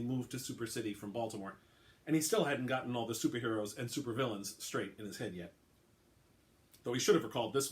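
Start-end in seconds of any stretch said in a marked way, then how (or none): none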